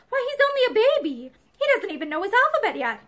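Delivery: high, quickening indignant protest. A narrator's reading. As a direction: defend your small son hotly. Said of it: "her eyes blazed".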